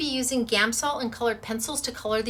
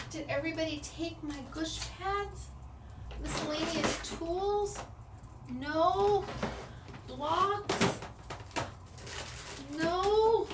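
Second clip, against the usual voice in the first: high fretful voice; 6 semitones higher, 7 dB quieter, slower than usual. Real, not acted. Searching frantically, high voice.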